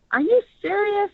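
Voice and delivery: can't believe what you're hearing; incredulous. Shown as a demonstration. Director shows the actor the line, incredulous. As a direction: pitch leaps high in disbelief. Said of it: "It's like you're incredulous".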